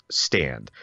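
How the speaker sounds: ordinary talk, nothing marked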